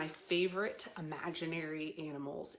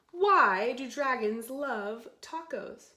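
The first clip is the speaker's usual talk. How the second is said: slow, loud wondering read; reading to children; 4 semitones higher, 10 dB louder, slower than usual. Acted. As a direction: pose the question to wide-eyed kids. Reading book line.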